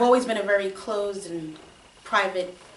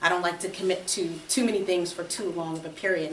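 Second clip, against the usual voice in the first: ordinary talk, nothing marked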